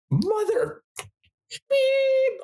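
very high squeaky bleeped curse; mock swearing yell. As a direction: squeal out a censored swear word. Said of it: "I just wanted to go".

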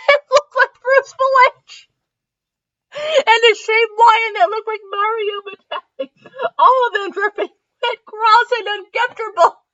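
helpless high laughter through the words; real laughing. Real, not acted. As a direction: try to read on while cracking up. Reader cracks up at own story.

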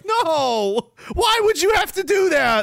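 high-pitched, hammed-up disbelief; comically aghast high voice; mock incredulous protest. Acts clueless German settlers' reaction.

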